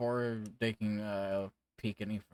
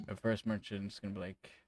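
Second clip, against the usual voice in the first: usual speaking voice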